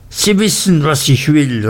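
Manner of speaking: aunt seizes Uriah, crying out her demand; fierce cry. A narrator's reading. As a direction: cry it out while gripping his collar. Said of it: "rief sie".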